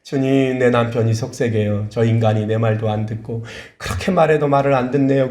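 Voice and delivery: ordinary talk, nothing marked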